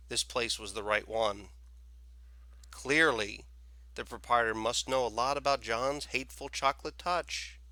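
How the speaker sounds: plain reading voice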